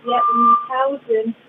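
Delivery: ordinary talk, nothing marked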